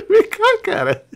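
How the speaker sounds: high, loud laughing taunt; gleeful loud game taunt; excited taunt. Real, not acted. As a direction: call the enemy out with a laugh.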